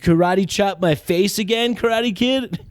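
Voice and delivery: mock him like a schoolyard bully; taunting thug. Italian Karate Kid taunting the old Karate Kid, jeering.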